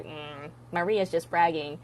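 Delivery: low grumbled disapproval; acted low-pitched put-down; disapproving enemies. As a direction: mutter it like a jealous rival. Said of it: "if you say that to your enemies".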